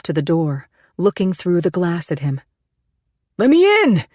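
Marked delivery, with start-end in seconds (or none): 3.4-4.0 high, loud shout over a storm; desperate shout to be let in. A narrator's reading. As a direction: yell it through the door over the wind. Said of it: "he shouted over the rain and the wind".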